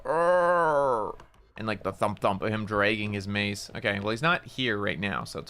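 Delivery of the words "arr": drawn-out mock monster "arr", raised in pitch; imitated monster growl. Player mimics what Red Man was heard doing, imitated growl. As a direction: imitate a lurking monster's long cry. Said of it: "I heard him go".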